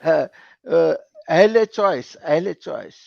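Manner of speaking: ordinary delivery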